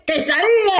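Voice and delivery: Quesadilla! shouted high with Spanish flair; proud Spanish pronunciation. Shown as a demonstration. Showing off to viewers, proud Spanish pronunciation. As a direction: burst out Quesadilla! like a proud native. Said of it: "proud Spanish flourish".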